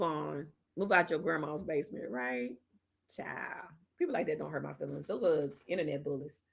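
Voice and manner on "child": whispered aside. Real, not acted. About internet bullies, a dismissive whisper.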